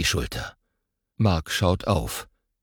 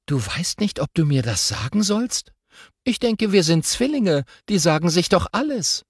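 put-on higher, put-out little girl; indignant girl voice; 10 semitones higher than usual. Girl protesting to her twin brother, indignant. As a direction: twins tell each other everything, so protest.

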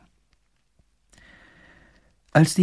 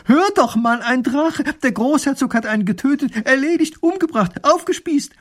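breathless excitement; 13 semitones higher, 5 dB louder than usual. A narrator's reading. Wife excitedly tells husband.

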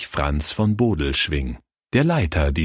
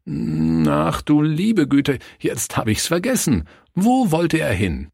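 higher, flustered searching for a word; feigned forgetfulness; 8 semitones higher than usual. A narrator's reading. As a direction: act as if it slipped your mind. Pastor pretends to forget the story.

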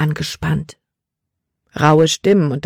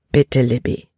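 gentle but firm; 4 semitones lower than usual. Acted. Father asking his daughter to obey, low and gentle. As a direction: urge gently, expecting to be obeyed. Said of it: "Kapitän Norstads Stimme war sanft".

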